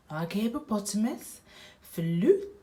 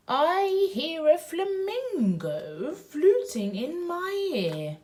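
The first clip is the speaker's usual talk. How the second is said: high, sing-song fluting voice; playful high reading; high flamingo voice; 9 semitones higher, 3 dB louder than usual. Pretend. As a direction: float it up high like a bird.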